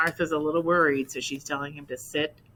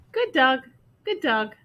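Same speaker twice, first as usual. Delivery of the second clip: parrot voice; 9 semitones higher, 7 dB louder than usual. Acted. Parrot repeats praise.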